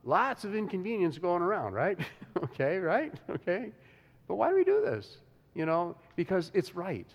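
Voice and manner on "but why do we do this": high, louder rising question; pitched-up preacher's question; rhetorical question. Real, not acted.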